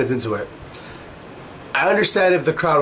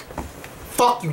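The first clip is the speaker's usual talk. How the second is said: whispered curse; 6 semitones higher, 7 dB quieter than usual. Real, not acted. Muttered curse at WWE.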